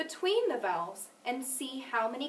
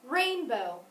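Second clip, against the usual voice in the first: accent the first syllable of Rainbow; stress on first syllable. Shown as a demonstration. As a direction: lean on the Rain in Rainbow, bow falls away. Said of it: "First syllable".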